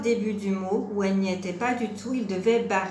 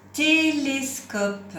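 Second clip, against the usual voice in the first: very slow, raised-pitch dictation; teacher's model word, stretched and higher; 5 semitones higher, much slower than usual. Shown as a demonstration. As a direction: draw out every syllable for a learner. Teacher dictates word for syllable listening.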